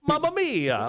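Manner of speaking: hammed-up bad Italian accent, pitched high; a cartoon Italian exclamation in a bad Italian accent; over-the-top bad Italian accent shout. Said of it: "talk badly in a bad Italian accent".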